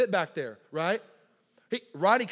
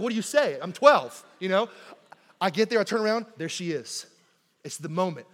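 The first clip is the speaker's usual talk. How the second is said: normal talking voice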